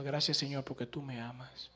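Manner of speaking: low, hushed thanks to God; tearful prayer, imitated. Pastor on his knees thanking God, mildly tearful. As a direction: softly imitate a weeping man praying. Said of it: "y llorando, y llorando".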